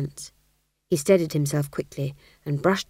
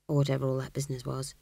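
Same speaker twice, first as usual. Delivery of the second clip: usual speaking voice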